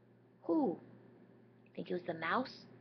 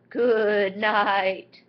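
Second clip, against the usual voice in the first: much louder hyena call; 3 semitones higher, 13 dB louder than usual. Acted. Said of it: "The hyena says".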